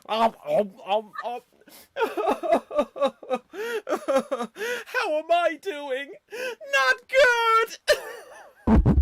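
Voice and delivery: high laughing cry; Joey with leprosy. Acted. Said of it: "Joey Tribbiani suffering from leprosy".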